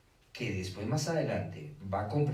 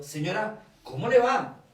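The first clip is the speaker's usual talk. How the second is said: friendly greeting; 10 semitones higher, 9 dB louder than usual. Retold or imitated. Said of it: "la señora que estaba al aseo".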